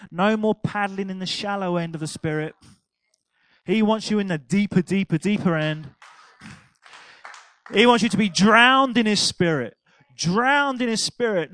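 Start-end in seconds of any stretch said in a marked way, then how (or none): 3.6-5.9 building preacher's call. Acted. 7.7-11.5 loud, rising preacher's call, drowned driven home; preaching at full force. Acted.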